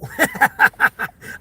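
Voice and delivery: high amused laugh; laughing at the joke; real laugh. Real, not acted. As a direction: burst out laughing. Laugh after punchline.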